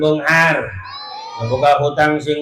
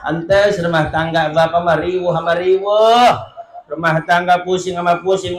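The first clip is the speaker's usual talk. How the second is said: normal talking voice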